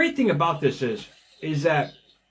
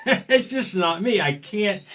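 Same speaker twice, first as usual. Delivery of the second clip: laughing; 7 semitones higher than usual. Real, not acted. Laughing about not retiring.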